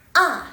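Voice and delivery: "A" short, high and loud, dropping fast; firm falling tone. Shown as a demonstration. Teacher shows fourth tone on A, firm falling. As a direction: strike "A" high and let it fall. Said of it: "dia dari atas ke bawah".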